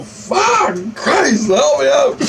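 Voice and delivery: very high, loud reenacted scream; panicked cry for help. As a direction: scream for rescue in sheer panic. Reenacting panicked plea to Christ.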